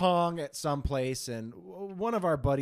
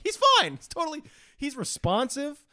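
much higher and louder, insisting he's fine; emphatic reassurance; 12 semitones higher, 6 dB louder than usual. Retold or imitated. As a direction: burst out to brush off the worry.

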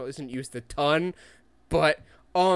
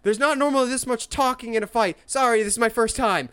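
put-on lightly raised teen quip; quippy teen hero; 7 semitones higher than usual. Fan quoting Spider-Man's fight quips, mildly higher. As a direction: toss it off like a nervous kid.

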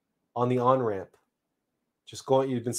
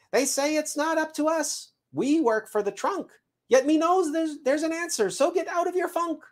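very high piping squirrel; squirrel messenger reporting. Acted. Squirrel urges the seed out of its funk, high chirp. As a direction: pipe the news in a squeaky-high squirrel pitch.

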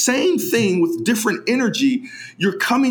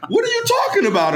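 mock outrage; much faster than usual. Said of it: "mock outrage, fast high".

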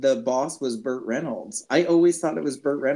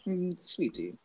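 whispering; 5 semitones higher, 9 dB quieter, slower than usual. Pretend. Teasing break banter.

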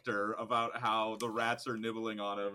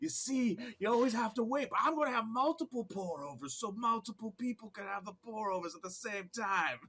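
put-on Southern accent; 9 semitones higher than usual. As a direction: play a Southern wannabe barista pitching his dream. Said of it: "Why is he suddenly Southern?".

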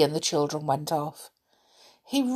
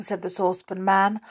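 usual speaking voice

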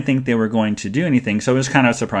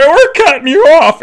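loud and very high, slow mock-stern call; joking shout; 8 dB louder than usual. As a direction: bellow it playfully at the table. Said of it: "and I said".

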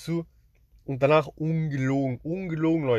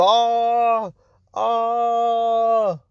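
long, high, held 'aah' scream; imitated scream; 8 semitones higher, 6 dB louder than usual. Retelling a nightmare to listeners, imitated high scream. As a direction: mimic a woman's drawn-out high, loud scream. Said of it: "ich hör nur so ein Geschrei".

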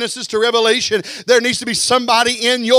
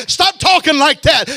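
preaching; 5 semitones higher, 5 dB louder than usual. Acted. Building preaching climax.

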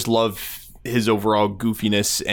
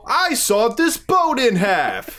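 infomercial pitchman; 10 semitones higher than usual. Retold or imitated. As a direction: shout it like a TV pitchman. Quoting Phil Swift infomercial.

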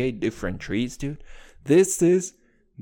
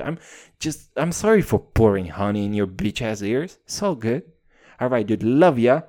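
usual speaking voice

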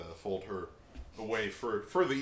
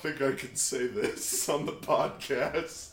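stifled giggles; 6 semitones higher, 7 dB louder than usual. Real, not acted. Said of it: "trying not to laugh".

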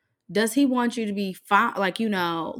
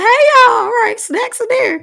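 much higher, louder hostess call; breezy hostess greeting; 12 dB louder than usual. Acted. Host greeting visiting friends from the other room, acted high call. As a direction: call out a quick high-pitched hello to company. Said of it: "be like".

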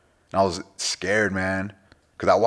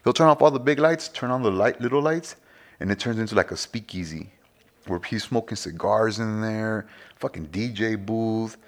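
normal talking voice

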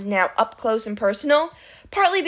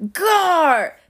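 high, loud monster bellow; 8 semitones higher, 5 dB louder than usual. A narrator's reading. An unseen monster startles the climbers, bellowing. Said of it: "bellowed another voice".

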